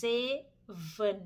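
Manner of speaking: zeven slowly, with a voiced z at the start; Dutch zeven. Shown as a demonstration. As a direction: stretch out zeven. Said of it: "zeven นะคะ". (ออกเสียง zeven ช้าๆ ขึ้นต้นด้วย z)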